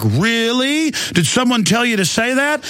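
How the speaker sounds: high, far-off prying voice rising at the end; mock suspicious stranger. Dad mimics suspicious stranger.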